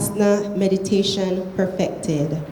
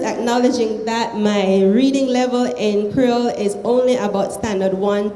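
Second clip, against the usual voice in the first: usual speaking voice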